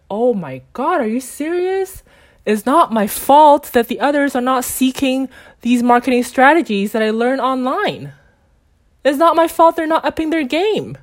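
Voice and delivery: high, loud, indignant protest; retold frustration. Arguing with the compliance rules, high indignant retold protest. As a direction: re-enact being mad, pitch leaping up. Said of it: "I got pretty mad and I got pretty frustrated".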